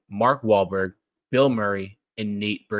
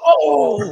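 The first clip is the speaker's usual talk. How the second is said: loud, very high mock gasp of horror; crowd's shocked "oh"; 9 dB louder than usual. Everyone watching the squirrel get spiked, loud mock outcry. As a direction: cry out like an appalled gallery.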